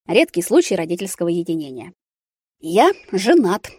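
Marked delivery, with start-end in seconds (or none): 2.6-3.7 slow, weighty blurt of a confession. A narrator's reading. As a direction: drop it like a bombshell. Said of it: "выпалил я". (выпалить признание)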